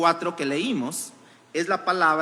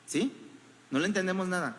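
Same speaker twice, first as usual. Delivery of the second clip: ordinary talk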